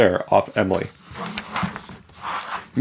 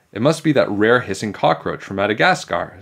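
ordinary delivery, nothing marked

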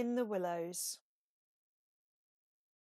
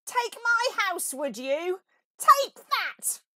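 very high, loud battle cry; furious shout; 11 semitones higher, 12 dB louder, slower than usual. A narrator's reading. Toad drives the weasels out with a very high, very loud shout. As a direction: hit back with fury. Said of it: "shouted Toad".

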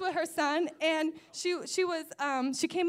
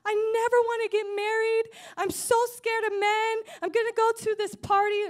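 imitated crying; 7 semitones higher, 5 dB louder than usual. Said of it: "this astrologist starts crying".

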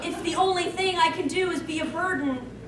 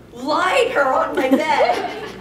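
slow, louder, stressing lied; mocking the misprint; 6 dB louder, much slower than usual. Shown as a demonstration. Reader mocking the script's typo, stressing lied. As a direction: lean on lied with a laugh. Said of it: "yes, I apparently".

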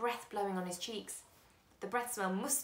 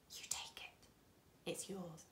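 much softer near-whisper; secretive hush; 12 dB quieter than usual. A narrator's reading. Old man hands over bag.

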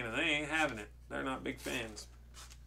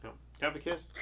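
ordinary delivery, nothing marked

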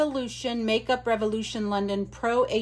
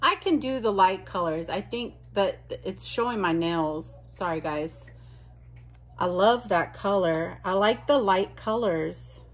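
ordinary delivery

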